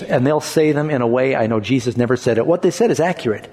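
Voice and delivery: ordinary talk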